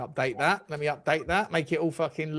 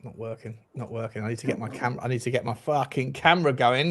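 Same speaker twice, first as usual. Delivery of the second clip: ordinary delivery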